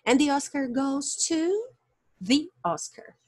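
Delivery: THE said "thee" before Oscar; teacher's example, THE pronounced "thee". Shown as a demonstration. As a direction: model THE with an ee vowel before the vowel. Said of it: "A pronúncia the quando a palavra seguinte".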